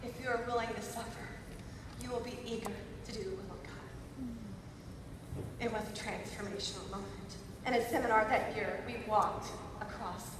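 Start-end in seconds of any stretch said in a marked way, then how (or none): 0.0-3.9 softer, calm voice of God. Retold or imitated. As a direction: speak it gently as God's answer. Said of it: "I so clearly heard God's voice say".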